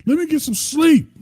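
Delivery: high cranky wife voice; mock grumpy wife. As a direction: snap it like you got woken up.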